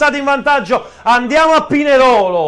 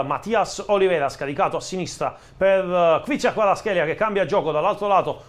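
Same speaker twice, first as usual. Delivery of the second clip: play-by-play commentary; 4 semitones lower than usual. Acted. Live play-by-play of the match.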